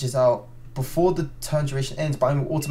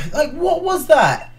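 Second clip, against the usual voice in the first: high, loud, drawn-out disbelief; outraged; 14 semitones higher, 5 dB louder, slower than usual. Real, not acted. Gamer baffled by the game's ruling, outraged. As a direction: demand an answer, really mad. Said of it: "I'm so freaking mad".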